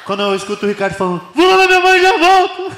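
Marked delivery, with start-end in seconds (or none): 1.3-2.7 very high, loud parting shout; excited yell. Acted. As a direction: holler it as you race off. Ricardo yelling as he runs off.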